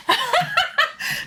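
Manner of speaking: squealing laugh; a high, bright laugh burst; high burst of laughter. Real, not acted. Laugh after joke.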